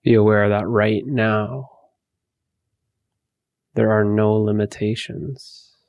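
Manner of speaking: slow, spacious meditation voice; guided meditation. Acted. Teacher guiding listeners to empty their thoughts, guided meditation. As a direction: lead them gently inward, with long pauses.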